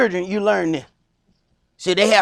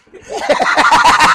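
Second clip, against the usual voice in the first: laughing; 6 semitones higher, 5 dB louder than usual. Real, not acted.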